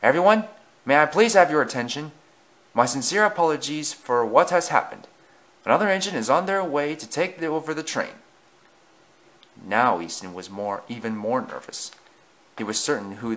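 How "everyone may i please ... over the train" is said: raised, higher announcing voice; public address. A narrator's reading. Station master apologising to angry passengers, announcing. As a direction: project it to a crowd. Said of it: "addressed the passengers".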